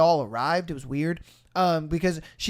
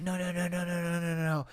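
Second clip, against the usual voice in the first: much softer rapid string of no; insistent refusal; 9 dB quieter, faster than usual. Retold or imitated. Girl refusing her friend's Uber plea, much softer insistent. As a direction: shut it down under your breath.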